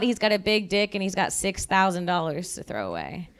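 normal talking voice

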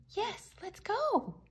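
high, drawn-out eager agreement; excited child. A narrator's reading.

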